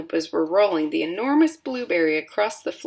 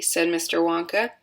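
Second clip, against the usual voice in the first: ordinary delivery, nothing marked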